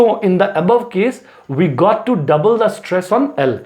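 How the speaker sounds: ordinary talk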